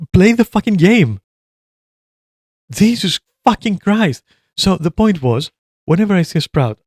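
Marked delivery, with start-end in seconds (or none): 0.1-4.2 exasperated rant. Real, not acted. Ranting at toxic teammate.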